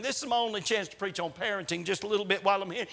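ordinary delivery

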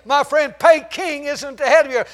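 loud, high, booming preacher cry; full-force preaching. Acted. Preacher to his congregation, booming full-force preaching. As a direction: preach it out to the back row.

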